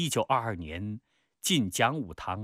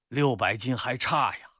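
loud, raised-pitch declaration; speaking loudly. Acted. Cousin answers Peng before the accompanying cadre, loudly. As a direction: announce it loudly for others to hear. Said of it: "大声地说".

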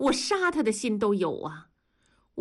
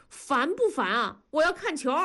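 mock yelled complaint, pitch jumps higher; a son half-shouting over the TV; 7 semitones higher than usual. Son yelling at his sister to get the match back, mock shout. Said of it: "大宝就喊".

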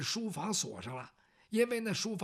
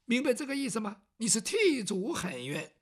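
ordinary delivery

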